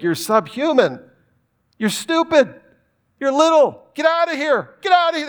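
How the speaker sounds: put-on high, sneering voice shooing kids away; scornful disciples. Disciples shooing the children away, high and sneering. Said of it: "the disciples were looking at these kids like".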